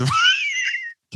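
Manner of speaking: high shouted laugh. Real, not acted. Laughing at the story.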